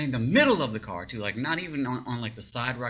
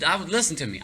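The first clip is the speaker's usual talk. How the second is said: ordinary talk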